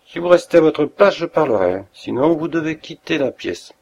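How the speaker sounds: a feverish detective warning his friend to stay back; angry warning. A narrator's reading. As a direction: hold him off with flaring anger. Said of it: "regard flamboyant de colère".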